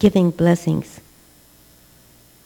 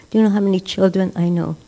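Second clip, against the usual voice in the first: normal talking voice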